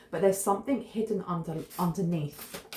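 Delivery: ordinary talk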